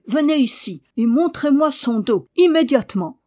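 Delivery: high, loud, imperious order; commanding. A narrator's reading. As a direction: order it with no room for delay. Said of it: "commanda-t-elle".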